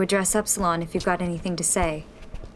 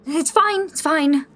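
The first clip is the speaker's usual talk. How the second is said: hurried reassurance; 8 semitones higher, 5 dB louder, slower than usual. Acted. Calming teammate after fight.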